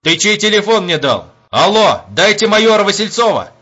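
loud, high irritated demand. A narrator's reading. Major demands the commander from a wrong number, loud and demanding. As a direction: bark it into the receiver. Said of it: "потребовал он".

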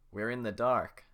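loud worried group voice; alarmed in the dark; scared villagers. Acted. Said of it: "That's the men saying".

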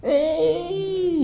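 high, drawn-out mock baby wail; long high "eee" of a mimicked baby; imitated baby cry. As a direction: wail like a crying infant, imitating. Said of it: "¿Viste los lloros de los niños?".

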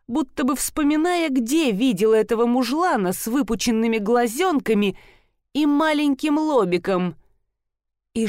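usual speaking voice

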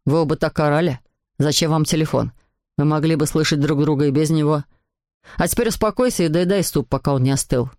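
low-pitched dad telling off son; reproving father. A narrator's reading. Papa tells Nicolas to settle and eat, low reproving voice.

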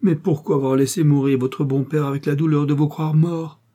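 violent emotion. A narrator's reading. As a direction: let the feeling burst out. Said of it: "la violente émotion qu'il éprouvait".

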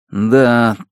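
plain reading voice

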